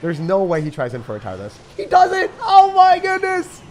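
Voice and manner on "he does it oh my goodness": high, very loud cry of disbelief; high-pitched gamer outburst; shocked yell. Real, not acted. Shocked reaction to game move.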